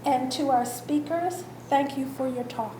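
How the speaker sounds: usual speaking voice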